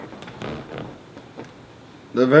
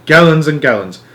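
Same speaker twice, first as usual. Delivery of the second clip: excited; 4 semitones higher, 5 dB louder than usual. A narrator's reading. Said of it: "cried Mister Wonka".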